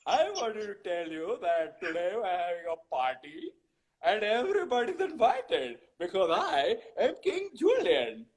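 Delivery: hammed-up royal party host with put-on accent; King Julian. Voice actor greeting as the Madagascar cartoon king, showy comic impression. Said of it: "we all heard King Julian from Madagascar saying".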